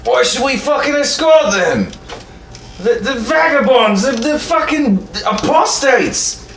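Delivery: high-pitched, outraged Templar shout; pissed off. Acted. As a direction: spit out the insults at full outrage. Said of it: "And the Templar's pissed off".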